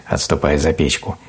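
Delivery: ordinary delivery, nothing marked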